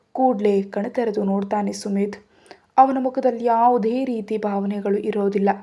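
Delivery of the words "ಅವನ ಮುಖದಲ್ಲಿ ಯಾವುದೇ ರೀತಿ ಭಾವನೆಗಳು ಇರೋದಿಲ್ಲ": ordinary delivery, nothing marked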